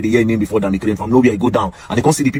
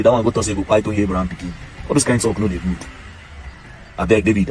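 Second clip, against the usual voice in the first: ordinary talk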